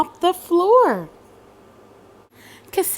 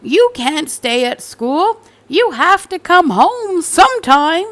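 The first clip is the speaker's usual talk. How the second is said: louder, firm fatherly scolding; exasperated father; 7 semitones higher, 6 dB louder than usual. Acted. Father telling Cassandra she must come home, firm and louder. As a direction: lay down the law to your child. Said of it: "said her father".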